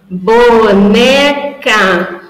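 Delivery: slow syllable-by-syllable boneca; syllables split apart. Shown as a demonstration. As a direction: say boneca slowly for a child to pick the first piece. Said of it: "eu vou falar pra ela".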